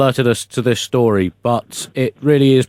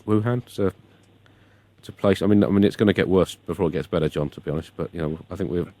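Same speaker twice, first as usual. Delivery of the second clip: much softer, fast, muttered aside; hushed aside; 6 semitones lower, 7 dB quieter, faster than usual. Real, not acted. Host hastily mumbles past an awkward joke, hushed. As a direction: drop it low and rush past.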